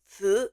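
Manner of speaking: "zi" in a rising Mandarin second tone. Shown as a demonstration. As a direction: let "zi" climb like a question. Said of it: "in the second tone".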